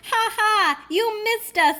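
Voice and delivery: high, mocking fox voices; gleeful high taunt; taunting foxes. A narrator's reading. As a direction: jeer at him like cheeky foxes. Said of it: "laughed the foxes".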